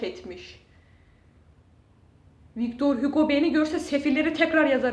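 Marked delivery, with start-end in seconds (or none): 2.6-4.9 louder, laughing through the joke; real self-mocking laughter. Real, not acted. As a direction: laugh at your own bad luck. Joking about his misery.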